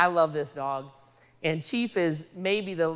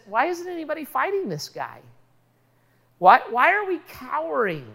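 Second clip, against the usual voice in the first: higher-pitched, indignant string of questions; incredulous challenge; 5 semitones higher than usual. Retold or imitated. David challenging the cowering armies of Israel, incredulous. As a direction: demand answers as an incredulous David.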